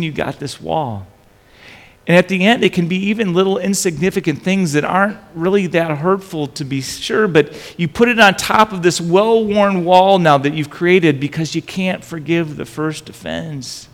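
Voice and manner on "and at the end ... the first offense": rising preaching. Acted. Preaching climax on resentment.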